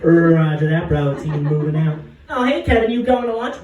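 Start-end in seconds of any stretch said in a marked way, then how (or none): 2.3-3.6 higher, louder friendly office voice; a chirpy coworker calls out; coworker greeting. Pretend. Coworker cheerfully catching him sneaking to lunch.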